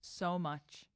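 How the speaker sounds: soft and slow, a dreamy confession; tender swoon. Pretend. Podcast host acting the boyfriend's answer, soft and slow. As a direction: breathe it like a lovesick hero.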